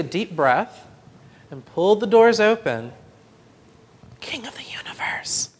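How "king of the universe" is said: hushed unvoiced whisper. A narrator's reading. Boy whispering a prayer at the ark. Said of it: "he whispered".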